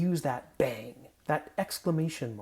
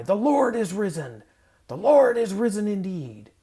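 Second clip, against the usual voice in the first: loud, higher shout of "The Lord is risen!"; joyful shout; 5 semitones higher, 8 dB louder than usual. Shown as a demonstration. Leader and congregation shouting the Easter reply, exultant. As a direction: fling it out like the doors opening. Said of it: "then we all shout our reply".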